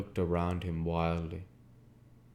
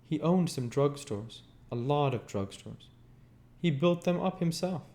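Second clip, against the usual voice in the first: higher, lightly voiced Daisy; a knowing Daisy, pitched up; Daisy's voice; 8 semitones higher, 4 dB louder than usual. A narrator's reading. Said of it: "she answered".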